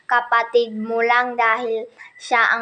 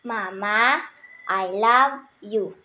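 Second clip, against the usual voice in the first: slow teaching voice; 5 semitones lower, 3 dB quieter, slower than usual. Shown as a demonstration. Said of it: "Sabihin mo".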